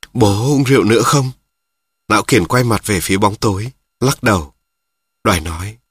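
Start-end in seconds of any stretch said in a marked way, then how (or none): none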